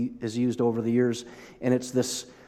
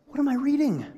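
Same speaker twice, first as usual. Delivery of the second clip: pitched way up in mock astonishment; incredulous, laughing at the text; amused disbelief; 10 semitones higher than usual. Real, not acted. Preacher marveling at the Bible passage, higher and amazed. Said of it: "It's just like".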